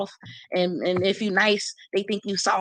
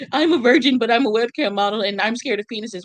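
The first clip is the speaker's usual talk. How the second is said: mocking quote; 3 semitones higher, 6 dB louder than usual. Mocking a commenter who claims to be a virgin. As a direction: sneer out her words like a silly girl.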